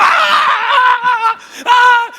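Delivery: loud high yelps; two short loud high cries; excited fish shrieks. Acted. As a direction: squeal like excited little fish. Little fish cry out.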